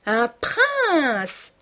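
high, singsong exclamation; musical cry. A narrator's reading. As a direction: cry it out musically, drawing out the word. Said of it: "cria-t-elle musicalement".

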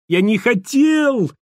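a high, slow, frantic wail; hysterical cry. A narrator's reading. Panicked prophet denying he meant it, high hysterical wail. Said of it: "припадочно закричал тот".

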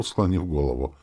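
usual speaking voice

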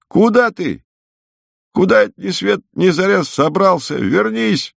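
high, urgent call at ordinary volume. A narrator's reading. Woman calling the guest back before dawn, crying out. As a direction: call him back with the pitch pushed right up. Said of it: "крикнула она".

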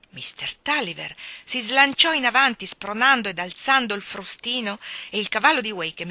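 ordinary delivery, nothing marked

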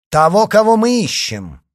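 high, loud retort; impatient shout. Acted. Leader presses D'Artagnan about the wanted man, loud. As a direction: snap back at full voice.

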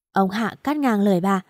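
ordinary delivery, nothing marked

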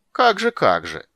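loud, mocking disbelief with a smile; sarcastic. A narrator's reading. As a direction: needle him sarcastically. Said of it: "язвительно улыбнулся король". (язвительно)

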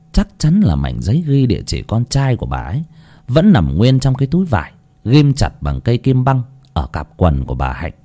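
ordinary delivery

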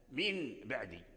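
"من بعدي" said softly with a plain N before B; low, quiet "min ba'di", noon left unchanged; mistaken reading demo. Shown as a demonstration. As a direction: keep the N distinct in "من", then "بعدي". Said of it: "خطأ، لحن".

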